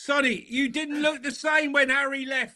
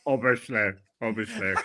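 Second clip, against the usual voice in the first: mock Harry Kane; 13 semitones lower, 4 dB quieter than usual. Mocking Harry Kane's catchphrase.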